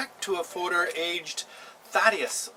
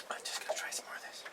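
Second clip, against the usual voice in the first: fast, hushed whispered aside; 13 dB quieter, faster than usual. Real, not acted. Vlogger whispers to viewers before another sip, fast hushed aside. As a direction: sneak the words out under your breath.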